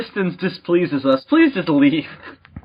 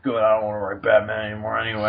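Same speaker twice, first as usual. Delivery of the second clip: grumpy writer; 8 semitones lower, 4 dB quieter, faster than usual. Retold or imitated. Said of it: "He's like".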